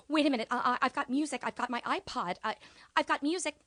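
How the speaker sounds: high, loud eager questions; excited teen student. Retold or imitated. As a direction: pump up like a kid hearing music. Student excited about the iPod.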